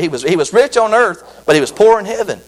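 ordinary talk, nothing marked

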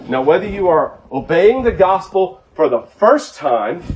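normal talking voice

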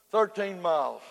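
ordinary talk, nothing marked

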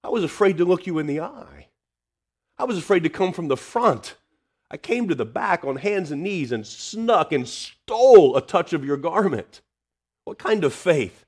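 high, loud, pleading disbelief; woman's fearful disbelief. Retold or imitated. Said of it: "she's got to be thinking".

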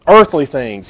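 ordinary delivery

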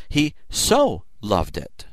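"so" slowed and leaned on; stress on so. Shown as a demonstration. Preacher contrasting plain love with "so loved". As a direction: press the word "so" in "he so loved it". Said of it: "emphatically used".

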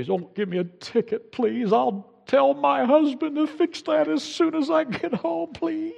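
high, begging driver's voice; pleading. Retold or imitated. Driver pleading with the officer for no ticket, high begging voice. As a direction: beg the officer not to write the ticket. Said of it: "And you say".

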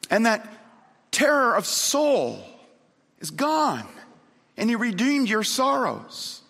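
high, impassioned preacher's cry; ringing proclamation to the congregation; fervent preaching. Real, not acted. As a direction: preach it at full pitch, pausing between phrases. Preaches at full force.

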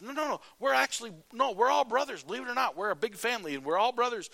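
high, loud, desperate protest; frantic pleading. Acted. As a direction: talk fast over each other to be believed. Brothers protest their innocence.

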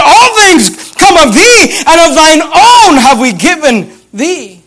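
high, slow preached verse; forceful proclamation. Shown as a demonstration. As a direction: ring it out over the congregation, leaning on "All". Repeats verse at full preaching force.

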